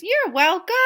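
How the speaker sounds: high, loud chicken voice; high, loud and pleased chicken. Acted. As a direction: say it like a proud little chicken. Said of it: "The chicken says".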